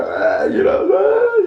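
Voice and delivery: high mock crying wail. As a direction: ham up a sobbing wail. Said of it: "I was bawling".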